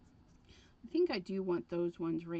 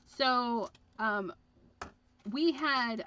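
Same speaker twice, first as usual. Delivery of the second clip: normal talking voice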